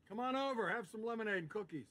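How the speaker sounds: high-pitched welcoming invitation; hospitable call. Acted. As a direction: call them over, voice lifted high. Inviting arriving family over.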